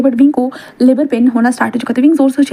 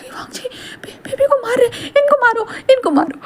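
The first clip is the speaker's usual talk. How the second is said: high tearful outrage; 12 semitones higher, 4 dB quieter than usual. Acted. Wing sees baby slapped.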